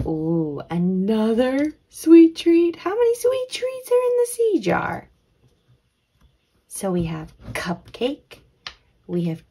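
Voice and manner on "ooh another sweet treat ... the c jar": excited delight. Pretend. Teacher finding a treat in the C jar, excited delight. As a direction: exclaim it in high, stretched-out wonder.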